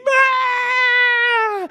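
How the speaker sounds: long high mock scream; imitated child's scream. Dad imitating son falling into the bed, screaming. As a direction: wail out one loud, drawn-out scream. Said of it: "starts screaming".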